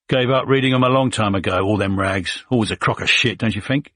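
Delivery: fast, lightly voiced Cockney grumble; a hint of Cockney scorn, rattled off; Cockney accent. Soldier mocks the newspapers, quick light Cockney accent. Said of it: "in a Cockney accent".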